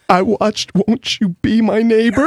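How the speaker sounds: high, slow, mock-tearful squeak; barely getting it out. Imitating a coworker at the office door, mock choked-up. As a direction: squeeze it out through pretend sobs. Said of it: "he could barely get it out".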